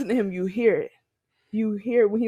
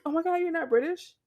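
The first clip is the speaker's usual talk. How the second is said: higher-pitched astonished question; retold surprise; 7 semitones higher than usual. Imitating interviewers finding she isn't British, surprised. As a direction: lightly mimic a shocked interviewer. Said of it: "they be like".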